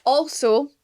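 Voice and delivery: ordinary delivery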